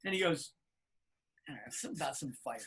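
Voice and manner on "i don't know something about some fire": much lower, softer, fast throwaway; offhand mumble. Retold or imitated. [name] telling the band about the call, offhand mumble. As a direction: toss it off unconcerned.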